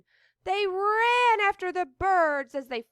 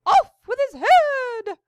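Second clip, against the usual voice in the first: commanding shout; loud shout; 7 semitones higher, 11 dB louder than usual. Acted. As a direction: bellow the order. Queen of Hearts quote.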